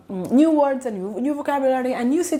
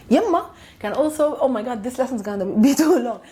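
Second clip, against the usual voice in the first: ordinary delivery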